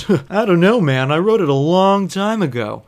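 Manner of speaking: lightly voiced drowsy shrug; dazed Seinfeld voice. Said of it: "Jerry Seinfeld on propofol".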